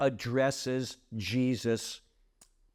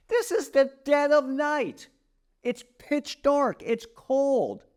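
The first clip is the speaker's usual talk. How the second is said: higher and louder, slowing into pitch dark; dramatic scene setting; 11 semitones higher, 4 dB louder than usual. Acted. Teacher sets the night scene for his class, dramatic and raised. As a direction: paint the cold night for the class, building suspense.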